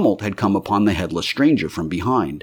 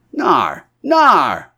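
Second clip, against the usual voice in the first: a higher, louder mocking "nar, nar"; rowdy pub heckle; jeering bar crowd; 7 semitones higher, 5 dB louder than usual. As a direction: jeer from the back of the bar.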